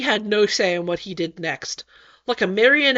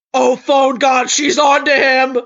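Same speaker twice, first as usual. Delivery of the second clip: panicked shout; 2 semitones higher, 6 dB louder than usual. Acted.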